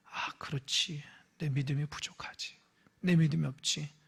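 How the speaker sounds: soft, low, humble confession; quiet humble reply to a rebuke; humble whisper. Shown as a demonstration. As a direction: whisper it like a contrite prayer. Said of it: "이렇게 반응하는 게 성경적".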